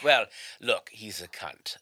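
upper-class British accent. Shown as a demonstration. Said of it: "There's the more upper class".

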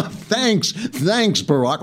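high, incredulous mock gratitude; mock-grateful, high and exasperated; sarcastic thanks.

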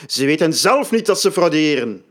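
high, loud, preacher-like zeal; sacred fervour. A narrator's reading. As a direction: proclaim it like a prophet. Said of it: "In zijn woorden ligt nu een sacrale gloed".

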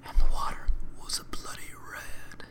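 eerie whispered narration; mock-horror whisper; ASMR whisper. Spooky ASMR joke reading.

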